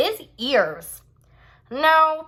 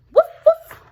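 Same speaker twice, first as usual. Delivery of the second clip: high, loud yappy woofs; squeaky puppy woof-woof; puppy bark; 10 dB louder than usual. Acted. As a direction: bark like a happy little puppy. Puppy barks in the story.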